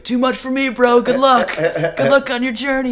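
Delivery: high, loud departing shout; calling out. Retold or imitated. Friend fleeing down the mountain to dad, hollering. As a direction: holler back as you drive away.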